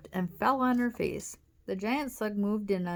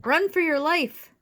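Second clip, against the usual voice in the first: high, loud shouted warning; urgent shout; 9 semitones higher, 8 dB louder than usual. A narrator's reading. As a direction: yell it for dear life. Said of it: "Wally shouted".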